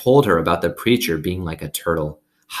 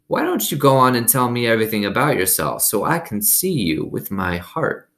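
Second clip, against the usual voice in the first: lightly voiced elderly neighbour; old woman voice; 5 semitones higher than usual. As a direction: speak as a kind old woman. Gloria Dump voiced in story.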